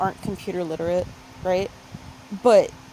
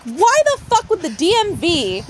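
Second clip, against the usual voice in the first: exasperated rant; 7 semitones higher, 6 dB louder than usual. Retold or imitated.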